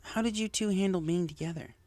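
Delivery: Rainbow, earnest pegasus. Acted. Rainbow asking Lyra how she handled her relationship, earnest high voice. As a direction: push to know, insistent.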